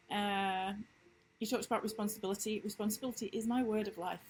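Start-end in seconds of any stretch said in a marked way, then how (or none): none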